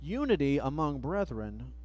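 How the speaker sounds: ordinary talk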